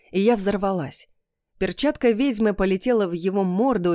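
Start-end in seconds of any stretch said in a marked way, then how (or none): none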